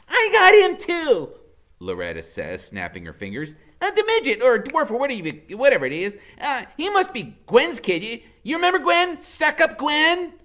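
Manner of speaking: high, loud chatter; high giddy woman. A narrator's reading. Loretta guessing to Iggy, high and loud. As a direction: gossip eagerly.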